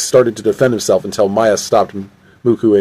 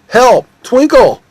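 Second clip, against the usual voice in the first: high, loud distress call; acted panicky yell; cry for help; 13 semitones higher, 5 dB louder, slower than usual. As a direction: call out desperately for rescue. Calling for rescue.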